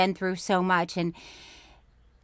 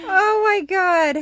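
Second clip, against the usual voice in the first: shocked; 10 semitones higher, 6 dB louder, slower than usual. Real, not acted. Reacting to gross story.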